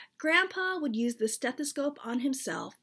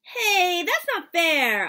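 put-on high, loud childish outcry; indignant protest; 7 semitones higher, 8 dB louder, slower than usual. Child objecting to Dad hoarding dumplings, indignant outcry. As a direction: cry foul at Dad.

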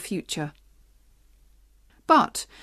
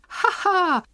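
high, hammed-up ha ha; read-aloud happy laugh; mock laugh; 10 semitones higher than usual. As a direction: laugh it out gleefully. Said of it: "began to dance happily".

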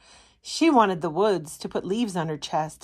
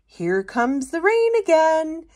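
much higher, cheery announcement; high bright plant voice; jungle plant; 9 semitones higher, 4 dB louder than usual. Acted. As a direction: sing out the coming rain.

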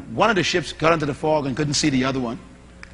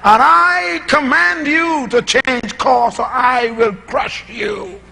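slow, louder, higher mock command that ends in a shout; ticked-off admiral; 7 semitones higher, 5 dB louder, much slower than usual. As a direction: bellow the credentials like a pompous admiral, hammed up. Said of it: "he got ticked off".

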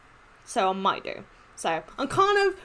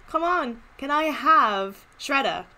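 normal talking voice

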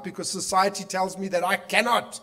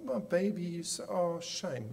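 soothing parent; 10 dB quieter than usual. Retold or imitated. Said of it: "I'm like".

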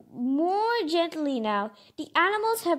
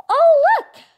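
much higher and louder, bursting with joy; excited cry; 13 semitones higher, 11 dB louder than usual. A narrator's reading. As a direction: shout it out in delighted discovery. Spotting the wish tree.

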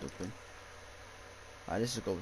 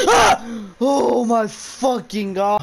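high, loud yell of fright, then a slow drawn-out curse; startled scream; 9 semitones higher, 12 dB louder, much slower than usual. Real, not acted. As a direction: shriek at the sudden scare. Jump scare in horror game.

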